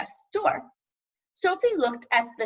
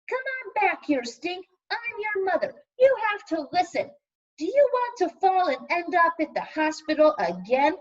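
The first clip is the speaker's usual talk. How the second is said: put-on high, raised mom voice calling after a child; scolding mother; 7 semitones higher than usual. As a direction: call him back like a worried mom.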